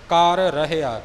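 ਕਰ ਰਹਿਆ with the two r sounds kept separate; both r sounds apart. Shown as a demonstration. Teacher separates ਕਰ ਰਹਿਆ for students, both r clear. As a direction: say ਕਰ, then ਰਹਿਆ, never merged into karahia. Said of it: "ਦੋਨੋਂ ਰਾਰਿਆਂ ਨੂੰ ਨਿਖੇੜ ਕੇ ਵੱਖਰਾ".

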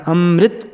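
ਅੰਮ੍ਰਿਤ said "amrit", r joined to m; ਅੰਮ੍ਰਿਤ with no b, half r; half r pronunciation. Shown as a demonstration. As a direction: model ਅੰਮ੍ਰਿਤ as am-mrit. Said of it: "ਰਾਰਾ ਅੱਧਾ ਉਚਾਰਨ".

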